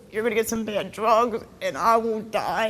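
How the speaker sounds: ordinary talk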